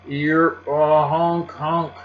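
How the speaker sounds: high, loud, broken honking bray; donkey bray. Acted. Donkey braying at Uncle Pete, high honks. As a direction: honk like a nervous donkey.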